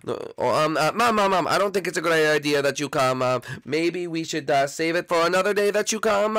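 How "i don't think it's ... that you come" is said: ordinary delivery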